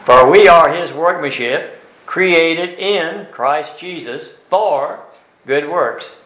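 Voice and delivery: slow, loud reading that leans on every little preposition; stressing the prepositions. Shown as a demonstration. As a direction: land each preposition hard and slow. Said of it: "pay attention to the prepositions".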